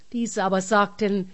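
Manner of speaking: usual speaking voice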